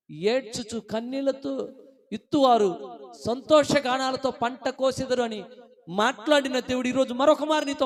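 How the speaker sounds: high, loud, ringing declamation; impassioned preaching. Real, not acted. Preacher recites the promise of a joyful harvest, impassioned preaching. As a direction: proclaim the promise to the congregation.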